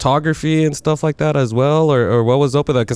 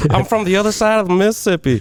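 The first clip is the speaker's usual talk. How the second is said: higher-pitched put-on Missouri accent; mock Missouri accent; 8 semitones higher than usual. Host teasing guest about his roots, mock Missouri accent. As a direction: tease with a Missouri twang. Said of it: "that little Missouri accent".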